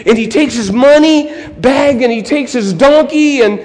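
high, loud, breakneck-action storytelling; excited story narration. A narrator's reading. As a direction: tell the attack with big excitement for a child.

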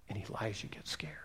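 hushed whisper; whispered aside to the congregation. Acted. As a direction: drop to a breathless whisper. Dramatic hush after threat.

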